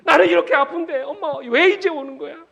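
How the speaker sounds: loud, high wail of a hurt son; reproachful child. Acted. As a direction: cry out in accusation at mother, high and loud. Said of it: "엄마를 원망하면서".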